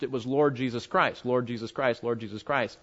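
ordinary talk, nothing marked

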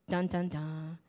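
low sung suspense sting; dramatic dun dun dun. Pretend. Preacher teasing the congregation, ominous sting. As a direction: hum the ominous reveal chord. Dramatic reveal sound effect.

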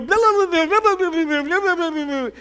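high, loud, fading blah-blah rant; mock complaining babble. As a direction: parody a self-pitying rant. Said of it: "You go".

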